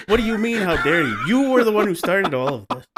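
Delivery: high, loud mock outrage; exasperated retort. Teller scolds the girl who started all this, loud exasperated retort. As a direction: argue back loudly, pitch jumping up in disbelief. Teller argues back at the character.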